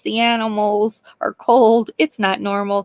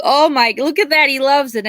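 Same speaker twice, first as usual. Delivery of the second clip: high, louder gushing imitation; doting aunt; 6 semitones higher, 4 dB louder than usual. Aunt watching the raccoon eat, high doting gush. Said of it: "She's like".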